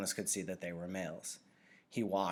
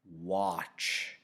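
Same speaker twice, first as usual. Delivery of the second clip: firm, odd-toned order, a touch louder; strange commanding voice; 4 dB louder than usual. Acted. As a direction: command him to look, gravely. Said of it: "commanded in a strange voice".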